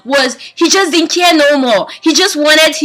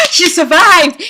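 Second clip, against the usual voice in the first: higher, slow gasp of relief; gasped surprise; 8 semitones higher, 4 dB louder, slower than usual. Real, not acted. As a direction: gasp it out in disbelief. Gasp at plot twist.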